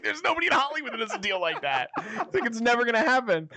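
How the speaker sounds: high, quick retold protest; incredulous refusal. Retelling his refusal of her deal, incredulous.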